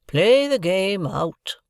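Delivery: put-on commanding old woman. A narrator's reading. Havisham commands the card game.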